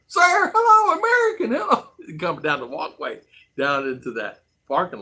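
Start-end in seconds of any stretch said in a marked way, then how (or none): 0.1-1.9 high falsetto hellos, pleading; imitated woman calling out. Women at the airport walkway hailing the American, high pleading calls. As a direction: hail him in a high woman's voice, begging for attention.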